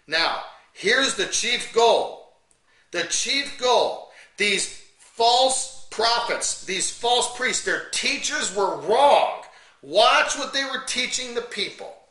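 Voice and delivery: slow, loud, drawn-out pulpit emphasis; preacher stressing each word slowly; forceful preaching. Acted. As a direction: hammer home the chief goal word by word. Preaches the chief goal slowly.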